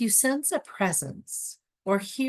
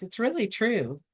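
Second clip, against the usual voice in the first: ordinary talk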